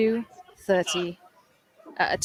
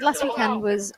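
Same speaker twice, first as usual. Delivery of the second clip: ordinary talk, nothing marked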